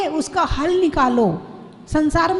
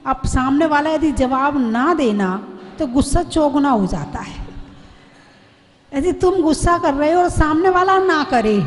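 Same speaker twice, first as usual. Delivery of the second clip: ordinary talk, nothing marked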